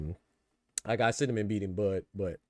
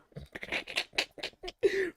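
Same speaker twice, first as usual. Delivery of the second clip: a squeaky airless giggle; high breathy wheeze laugh; 4 dB quieter than usual. Real, not acted. Laughs at [name] joke.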